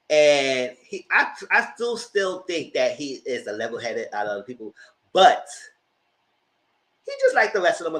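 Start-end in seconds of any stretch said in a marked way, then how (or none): none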